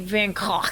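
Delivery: "Van Gogh" with throaty, raspy g and gh; Dutch pronunciation. Shown as a demonstration. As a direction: say "Van Gogh" gagging on the final gh. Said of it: "Dutch pronunciation".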